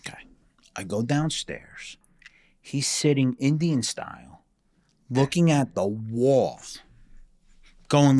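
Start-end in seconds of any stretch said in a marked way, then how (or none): none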